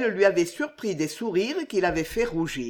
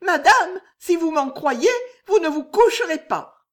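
very high, loud, tearful urging; urgent warning; 11 semitones higher, 8 dB louder than usual. Acted. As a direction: blurt out a desperate warning. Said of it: "résolution soudaine".

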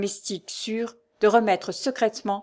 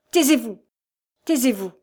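higher, emphatic command to be silent; sharp indignant order; angry cry; 4 semitones higher, 4 dB louder than usual. A narrator's reading. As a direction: cut him off in outrage. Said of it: "s'écria le vieillard".

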